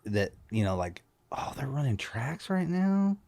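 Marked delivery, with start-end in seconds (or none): 1.3-3.2 softer, higher, incredulous question; quietly dismayed, imitating his own shocked reaction; hurt surprise. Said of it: "shocked, surprised, and hurt".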